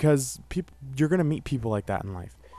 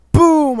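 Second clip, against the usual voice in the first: loud high shouted boom; big comic boom for the punch; punch sound effect; 14 dB louder than usual. Retold or imitated. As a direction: shout the impact like a punch landing.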